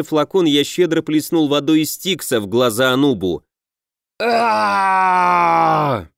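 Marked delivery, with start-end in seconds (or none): 4.2-6.1 long drawn-out howling scream; slow agonized demon wail. A narrator's reading. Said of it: "От истошного вопля демона".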